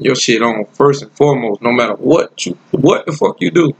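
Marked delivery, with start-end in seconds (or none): none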